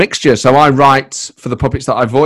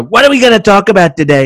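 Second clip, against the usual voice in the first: higher, louder Trump impression; 8 semitones higher, 5 dB louder than usual. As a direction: ask it like a brash Trump. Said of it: "And I go".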